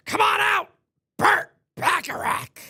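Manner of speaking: high, loud wrestling-boss bellow; Vince McMahon. Retold or imitated. Streamer summoning a ballplayer, Vince McMahon bellow. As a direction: summon him like a ring announcer. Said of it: "my Vince McMahon impression".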